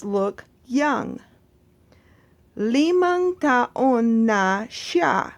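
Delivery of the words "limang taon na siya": slow, careful model sentence. Shown as a demonstration. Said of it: "slow model sentence".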